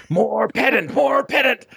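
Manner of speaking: rising whiny chant into shout; building pedant shout. Retold or imitated. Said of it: "encouraging the pedant voice".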